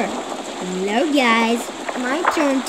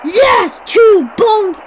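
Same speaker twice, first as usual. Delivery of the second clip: high, loud victory shout; cheering; 8 semitones higher, 9 dB louder, much slower than usual. Real, not acted. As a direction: cheer the win at full voice. Celebrating success.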